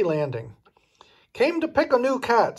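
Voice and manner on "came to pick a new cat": put-on high, pushy old witch voice; bossy witch. A narrator's reading. Hagglestone telling Sizzle why she came, bossy witch. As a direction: barge in and announce what you want. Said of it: "said Old Witch Hagglestone".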